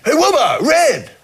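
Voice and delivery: high imitated hail across a footpath; calling out. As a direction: call the names like an eager passer-by. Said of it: "people would call out to us".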